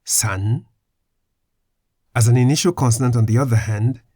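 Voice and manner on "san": "san" ending in N; final N sound. Shown as a demonstration. Teacher models the first syllable of sanya, final N sound. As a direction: say "san" rising, closed on an N. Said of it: "pronounced with an N sound at the end".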